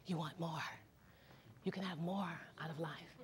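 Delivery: hushed, soft voice from within; a quiet voice urging from deep within; soft inner voice. Acted. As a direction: murmur it like a voice inside the head. The inner voice speaks.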